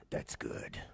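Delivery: low flat dry "That's good"; deadpan father voice. Retold or imitated. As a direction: drop it dry and low like a tired dad. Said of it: "And he said".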